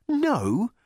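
high squeaky mimic of the question; mock the question in a piping voice; high mocking echo. Voice mocks Arthur's reply.

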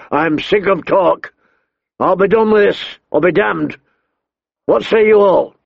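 high, heated royal shout; furious king. A narrator's reading. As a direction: slam the debate shut. King furious, ends the debate.

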